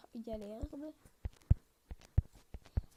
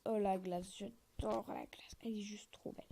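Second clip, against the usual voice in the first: whispered delight; 5 semitones lower than usual. Real, not acted. Gushing over ice block.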